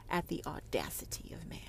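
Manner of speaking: breathy hushed whisper. Real, not acted. Describing the enraged prophetess, whispered. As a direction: let it fall into a whisper.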